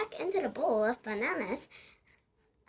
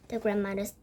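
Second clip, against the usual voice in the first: ordinary delivery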